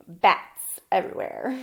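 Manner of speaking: ordinary delivery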